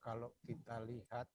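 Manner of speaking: faint, distant voice off mic; speaking too far from the microphone. Real, not acted. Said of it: "boleh suaranya dibesarkan, Pak, atau didekatkan mic-nya?".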